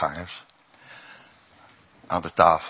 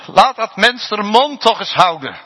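loud, high impatient complaint; irritated disciples snapping at her; annoyed disciples; 13 semitones higher, 9 dB louder than usual. Retold or imitated. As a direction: brush the woman off. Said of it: "zeiden de discipelen".

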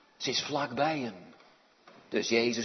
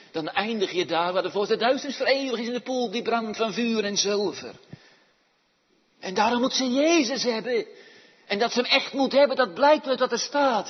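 loud, urgent pulpit delivery; forceful preaching; 8 semitones higher, 11 dB louder, faster than usual. Acted. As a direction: drive the warning home loudly. (krachtige, dringende prediking)

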